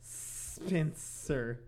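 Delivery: ordinary talk, nothing marked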